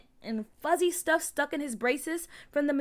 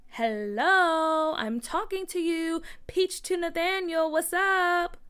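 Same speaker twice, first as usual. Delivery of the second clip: raised, drawn-out hello, higher pitched; calling for attention; 5 semitones higher, 4 dB louder, slower than usual. A narrator's reading. Peach tries to reach silent Nathaniel, calling for attention. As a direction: get an ignoring friend to answer. Peach calls unresponsive friend.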